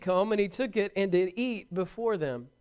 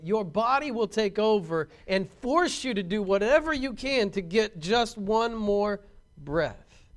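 normal talking voice

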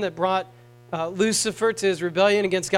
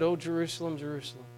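"Oh, Jerusalem" softer, the second "Jerusalem" fading almost to nothing; pained lament; 3 semitones lower, 8 dB quieter, faster than usual. Retold or imitated. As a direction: grieve it quietly, the voice dying away. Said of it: "you could hear the pain in his voice".

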